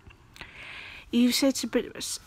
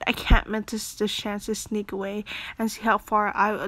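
usual speaking voice

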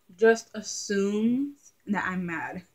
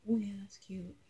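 whispered aside; 12 dB louder than usual. Real, not acted. Talking to herself mid-video, a whispered aside. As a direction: whisper it to yourself.

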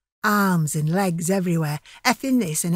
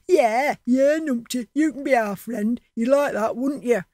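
cackling gang mockery; 4 semitones higher than usual. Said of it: "cackling noise".

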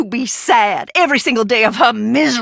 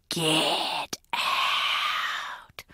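whispered low, like a ghost from the grave; 6 semitones lower, 11 dB quieter than usual. Retold or imitated. Quoting her ghost sister to the visitor, eerie whisper. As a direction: breathe it out as the dead sister's ghost. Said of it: "She also says".

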